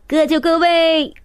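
loud coach barking orders; barking gym coach. A narrator's reading. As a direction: bark it like a coach at a meet. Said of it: "教练发布命令".

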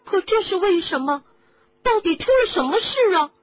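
high, loud urgent questions; urgent boy. Acted. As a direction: beg to know at once. Harry pleads to know.

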